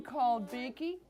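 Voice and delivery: usual speaking voice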